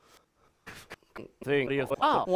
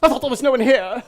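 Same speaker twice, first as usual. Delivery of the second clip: lightly voiced character, higher and louder; stage character; 4 semitones higher, 8 dB louder than usual. Actors in the play meeting in the house, lightly in character. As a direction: play the scene big and bright.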